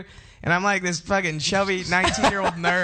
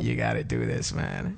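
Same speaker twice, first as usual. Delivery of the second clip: deep inner voice; 5 dB quieter than usual. Retold or imitated. Retold inner panic on stage.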